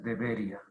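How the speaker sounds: DEBERÍA said de-BE-ria, ia as one syllable; wrong stress, as diphthong. Shown as a demonstration. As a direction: stress the BE in DEBERÍA and glide through ia. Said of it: "si fuera debería, habría una, un diptongo".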